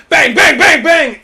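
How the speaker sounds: loud, high, rapid bangs; acted-out blows. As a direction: punch out each bang like a blow. Acting out hits to the head.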